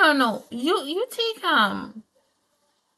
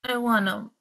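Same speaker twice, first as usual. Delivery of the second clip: ordinary talk, nothing marked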